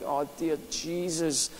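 soft, slow, sinking prayer of dismay; a quiet, slowed groan to Jesus; hushed dismay. Retold or imitated. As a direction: breathe it out as the heart drops. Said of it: "I'm like".

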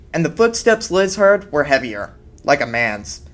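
plain reading voice

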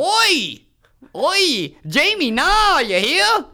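put-on high, shouted, wildly off German accent; failed German accent. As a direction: bellow a hopeless attempt at German. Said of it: "Give me a German accent".

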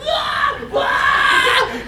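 two very high mock pain shrieks; imitated screaming. Patient who couldn't move, acted-out screams of pain. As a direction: scream it out, hammed-up agony. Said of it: "I'm just screaming".

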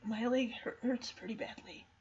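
faint, halting near-whisper; weak, quiet admission of pain; coming to, hurt. Acted. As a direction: fade to a whisper as if just waking injured. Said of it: "it takes him a second as he comes to".

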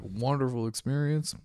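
ordinary talk, nothing marked

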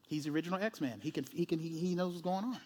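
usual speaking voice

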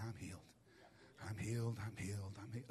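very soft, low repeated murmur, imitating lost voice; voiceless whisper. Voiceless woman trying to be obedient, whispering. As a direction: repeat it with barely any voice. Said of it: "able to speak above a whisper".

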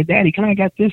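put-on high pleading child; little girl voice. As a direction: beg like a small daughter. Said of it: "She'll say".

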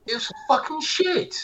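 high disgusted. Real, not acted. Finishing his rant about the film, high disgusted verdict. Co-host ranting.

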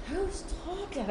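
high, drawn-out exasperated query; exasperated question. Retold or imitated. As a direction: stretch it out high, fed up. Irritated, asks who keeps talking.

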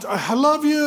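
high, loud, strained declaration; hammed-up pleading over a tantrum; strained affection. Dad to his thrashing kid, strained affection.

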